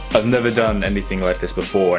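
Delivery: ordinary talk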